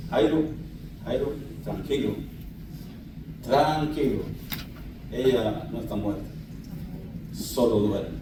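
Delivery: calm him almost in a murmur; tender comfort. Acted. Jesús consuela a Jairo. (Jesús consuela a Jairo en voz baja)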